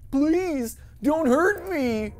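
high, slow begging; pleading. Pretend. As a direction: plead for mercy. Voicing scared character.

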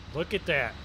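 usual speaking voice

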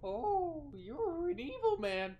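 cracking voice on a startled line. Real, not acted. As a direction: voice cracks up high. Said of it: "Why did my voice crack?".

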